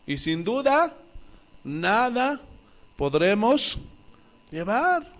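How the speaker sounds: cada palabra separada por pausas, "nada" acentuada; pausado, palabra por palabra. Acted. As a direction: deja caer "nada" y "podremos" con pausas. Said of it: "pausas marcadas predicando". (énfasis pausado en "nada")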